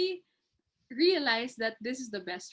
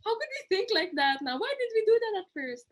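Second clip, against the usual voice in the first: amused disbelief; 11 semitones higher, 4 dB louder than usual. Retold or imitated. Retold friends laughing at themselves.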